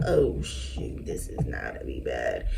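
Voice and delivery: hushed whisper to herself, dread creeping in; whispered inner panic. Retold or imitated. Retelling her doctor visit, whispering her dread. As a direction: breathe it out under your breath like bad news is coming. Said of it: "and I'm like".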